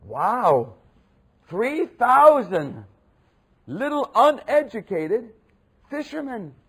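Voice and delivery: high, loud and drawn out in wonder; amazed exclamation. Real, not acted. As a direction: stretch the words in sheer awe. Marvelling at the converts.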